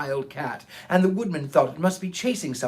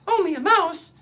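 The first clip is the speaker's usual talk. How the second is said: high, loud, offended squeak; a tiny mouse bristling with outrage; indignant mouse; 13 semitones higher, 5 dB louder than usual. Acted. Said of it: "cried the little animal indignantly".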